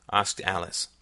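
plain reading voice

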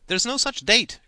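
high, loud schoolboy call; boy crying out. A narrator's reading. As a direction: shout the objection across class. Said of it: "cried a boy".

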